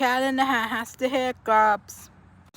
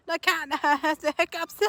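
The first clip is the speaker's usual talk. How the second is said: laughing; 7 semitones higher, 7 dB quieter than usual. Pretend. Teasing the hiccupping cat.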